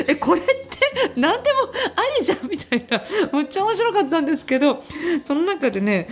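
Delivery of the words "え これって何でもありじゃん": high, laughing exclamation; amused. Retold or imitated. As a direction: squeal it out through laughter. Own reaction quoted, laughing. (笑いながら高い声で)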